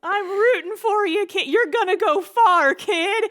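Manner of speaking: put-on root for the kid, overcome; teary drunk cheer. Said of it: "gets really teary".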